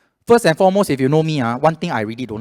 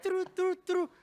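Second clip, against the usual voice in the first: high, soft imitation of a transfer tone; mouthed ringing tone; 8 dB quieter, slower than usual. Scammer faking the call transfer sound, high and soft. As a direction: trill it like a faked phone sound. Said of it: "They make the sound literally like".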